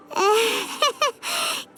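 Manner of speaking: a light giggle at herself; short, amused chuckle; pleased little laugh. Acted. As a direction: laugh off the big speech. Laughs at her own speech.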